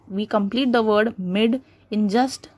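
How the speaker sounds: ordinary delivery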